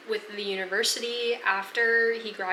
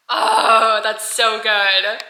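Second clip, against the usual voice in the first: loud, drawn-out delighted oh, slow and swooning; delighted gush; 11 dB louder, much slower than usual. Real, not acted. Reader gushing to viewers over a brilliant passage, loud and slow delight.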